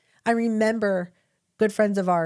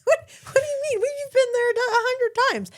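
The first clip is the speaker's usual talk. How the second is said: very high-pitched, exasperated disbelief; incredulous; 3 dB louder than usual. Retold or imitated. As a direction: squeal it as a baffled parent retelling. Mother's retold disbelief at child.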